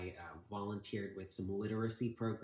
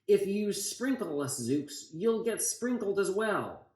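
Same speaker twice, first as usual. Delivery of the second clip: higher, louder storybook yell; yelled warning; 10 semitones higher, 7 dB louder than usual. Acted. Enemy yelling a warning at the pilot, storybook yell. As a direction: yell out a threat from afar. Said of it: "I heard a voice yell".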